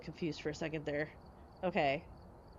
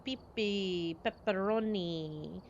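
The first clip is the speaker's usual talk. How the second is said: mock Italian; much slower than usual. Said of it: "in an accent".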